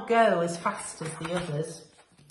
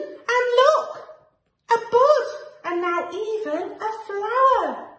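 much higher and louder, delighted; excited wonder; 12 semitones higher, 6 dB louder than usual. A narrator's reading. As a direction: point it out like a marvel to a child. Neighbour plant blooms.

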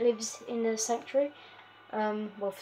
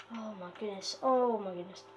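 ordinary talk, nothing marked

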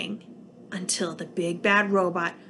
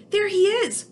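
much higher excited shout; crying out; 11 semitones higher, 3 dB louder than usual. Acted. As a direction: spot him with relief. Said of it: "cried Bork".

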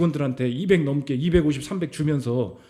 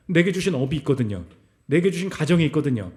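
usual speaking voice

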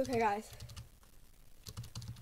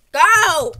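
sudden very loud yell; explosive, urgent holler; yelled command; 5 semitones higher, 18 dB louder than usual. Real, not acted. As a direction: shout it at the horse to move. Urging the horse on.

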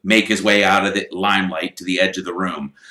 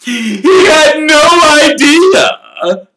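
showman voice; 12 dB louder, much slower than usual. Acted. Lockhart speech continues.

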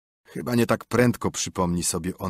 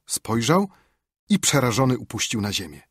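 ordinary delivery, nothing marked

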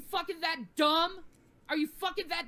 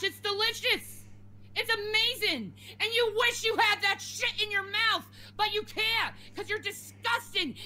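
pitched-up, indignant outburst; real angry rant; 3 semitones higher than usual. Real, not acted. Streamer telling online harassers to back off, angry. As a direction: fire back in raised, insistent protest.

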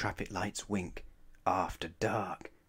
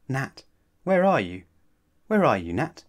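pretend searching; 5 semitones higher, 10 dB louder than usual. Anna pretends not to see.

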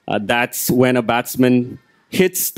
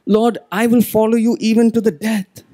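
fiery Peter; 10 semitones higher than usual. Retold or imitated. Peter vowing to Jesus he won't fall, fiery and raised. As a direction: swear it, all fired up. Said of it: "And then he says".

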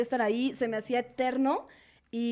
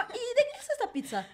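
much higher, whiny mock of the date; imitating a needy customer, laughing; mock bratty diner; 11 semitones higher, 3 dB quieter than usual. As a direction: play her as demanding and fussy. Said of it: "Y era como".

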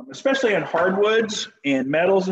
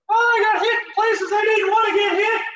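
very high squeaky mock voice; hammed-up falsetto greeting to his wife; Minnie Mouse squeak; 3 dB louder than usual. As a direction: squeak it like a cartoon mouse after a groin hit. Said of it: "I sound like Minnie Mouse".

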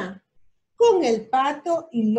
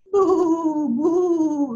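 loud higher bu bu honk; scared truck horn; 4 semitones higher, 4 dB louder than usual. A narrator's reading. The stuck truck honking in fright to the animals. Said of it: "gritó el camión, llamando muy asustado".

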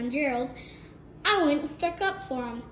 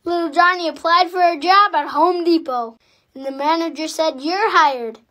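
loud, eager joke telling; 4 semitones higher, 11 dB louder than usual. Acted. Son telling Dad a joke, loud and eager.

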